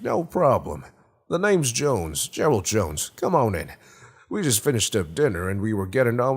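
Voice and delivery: hint of Jimmy Stewart swagger, all in one go; Gerald Jones, fifties swagger. A narrator's reading. Homeowner invites a stranger in with breezy fifties swagger. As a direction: rattle on like a folksy fifties host, ending on a high question. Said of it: "tiny hint of nineteen fifties swagger".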